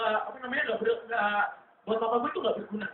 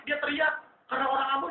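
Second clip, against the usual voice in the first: normal talking voice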